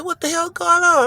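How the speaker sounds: retold alarm, pitched far up; a high disbelieving demand. Confronting the hospital staff, high alarmed voice.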